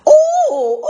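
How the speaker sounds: put-on high, loud little boy's yell. Little boy grabs Ping, high loud yell. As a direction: shout it like a small boy holding a duck. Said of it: "yelled the little boy".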